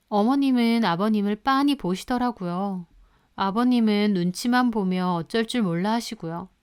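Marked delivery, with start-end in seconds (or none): none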